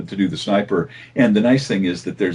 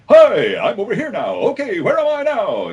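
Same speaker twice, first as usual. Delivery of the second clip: much higher, louder, hammy theatrical voice; over-the-top actor; 12 semitones higher, 3 dB louder than usual. Shown as a demonstration. Voice actor demoing Storm Spirit, a hammy over-the-top actor. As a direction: play a showy ham actor calling out. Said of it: "this kind of over-the-top actor dude".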